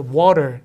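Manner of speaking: water with a tapped T and a strong final R; American strong R. Shown as a demonstration. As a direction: say water as "waw-derr". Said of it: "R 발음 세게 하고".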